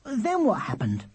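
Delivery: put-on very high, eager child's voice; piercing little brother. A narrator's reading. Charlie plying his brother with questions, piercing. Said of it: "plied his brother with endless questions".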